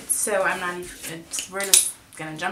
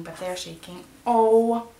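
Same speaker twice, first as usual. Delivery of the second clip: normal talking voice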